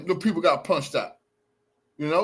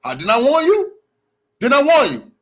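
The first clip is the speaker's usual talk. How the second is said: raised voice, insistent questioning; loud indignant challenge; 4 semitones higher, 6 dB louder than usual. Real, not acted. Challenging the woman over the warning, indignant. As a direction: demand an answer, loudly.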